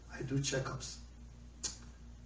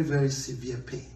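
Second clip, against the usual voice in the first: ordinary talk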